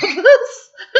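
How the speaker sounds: high loud burst of laughter. Real, not acted. Recalling Adrian's answer, laughing loudly. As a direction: crack up loudly.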